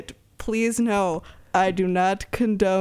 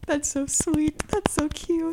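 higher, sing-song cooing over something cute; 7 semitones higher, slower than usual. Real, not acted. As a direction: gush like at a puppy. Cooing at Kaz's clap.

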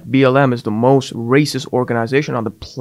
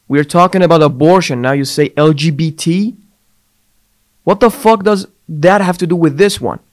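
louder and higher, exasperated outburst; angry rant; 4 semitones higher, 3 dB louder than usual. Real, not acted. Ranting at the president over his speech, angry and louder. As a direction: blow up at the absurdity.

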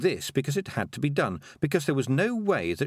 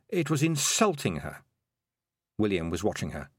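ordinary delivery

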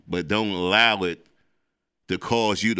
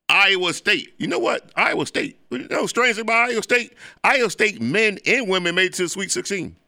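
excited; 9 semitones higher than usual. Real, not acted. Raving about Sweet Sixteen teams.

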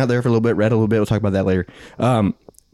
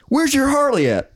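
much higher-pitched mock call; imitated eager stranger; 12 semitones higher than usual. Acting out the yelling stranger's question.

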